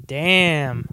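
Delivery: ordinary talk, nothing marked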